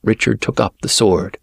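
plain reading voice

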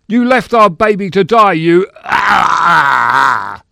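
very high, loud accusation breaking into a scream; full stage rage. Acted. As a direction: bellow the melodrama line until pain cuts it off. Actor performs line, then is hurt.

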